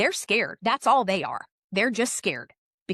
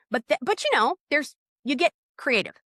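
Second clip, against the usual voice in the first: usual speaking voice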